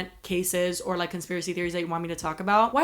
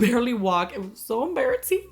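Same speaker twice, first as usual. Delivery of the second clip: usual speaking voice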